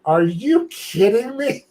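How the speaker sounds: high, drawn-out incredulous question; retold disbelief. Seeing own replay asleep on stream, incredulous. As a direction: stretch it out, loud and incredulous.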